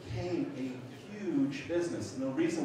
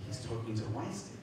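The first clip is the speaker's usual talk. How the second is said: softer mock accusation; suspicious realization; 5 dB quieter than usual. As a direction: report the discovery under your breath. Bingham voiced, first take.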